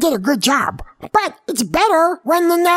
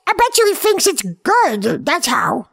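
usual speaking voice

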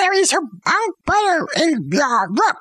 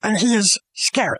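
ordinary delivery

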